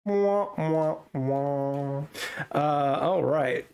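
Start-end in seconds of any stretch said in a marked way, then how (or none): 0.1-2.0 mock sad-trombone wah-wah-wah; imitated losing-game trombone. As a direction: sound the letdown horn, stepping downward. Mocking a disappointing card pull.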